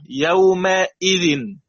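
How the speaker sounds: يومئذ slowly, clear hamza with kasra; slow tajweed demo. Shown as a demonstration. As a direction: carefully recite يومئذ. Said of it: "مكسورة وقبلها مفتوح". (يومئذ بتأنٍّ)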